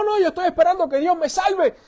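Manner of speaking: high-pitched, insistent waving away of help; stubborn refusal. Acted. Drowning man turns down the speedboat, stubbornly insistent.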